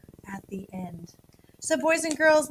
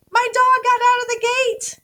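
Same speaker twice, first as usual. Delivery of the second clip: much higher and louder, a panicked yell; nervous yell; 12 semitones higher, 11 dB louder than usual. Retold or imitated. Owner calling out as the dog escapes, panicked yell. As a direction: shout it like the dog just ran off. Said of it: "So I might yell something like".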